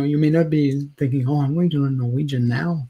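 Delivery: ordinary talk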